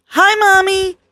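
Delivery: put-on high, eager toddler calling out; little boy voice. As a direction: squeal it like a happy little kid. Toddler greets mom on phone.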